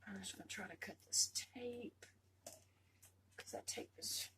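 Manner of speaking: whispered concentration; hushed focus on the tape. Real, not acted. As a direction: whisper as you work carefully.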